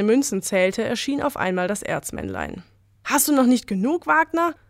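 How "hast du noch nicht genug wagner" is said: higher, louder taunting challenge; a mine spirit sneering at greed; mocking spirit voice. Ore spirit confronts greedy mine boss, taunting.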